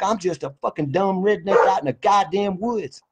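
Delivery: usual speaking voice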